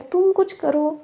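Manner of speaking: usual speaking voice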